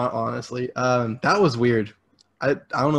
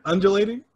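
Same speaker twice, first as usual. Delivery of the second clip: "undulating" higher, rising at the end; unsure questioning reading; 7 semitones higher, faster than usual. Real, not acted. Repeats the doubtful word.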